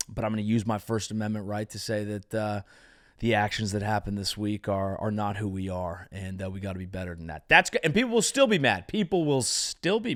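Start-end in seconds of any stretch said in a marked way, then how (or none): 0.1-7.5 sincere athlete statement. Acted. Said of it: "you basically say".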